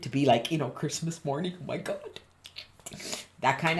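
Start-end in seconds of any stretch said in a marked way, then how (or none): 1.6-2.2 whispered excitement. Acted. Acting Christmas morning excitement.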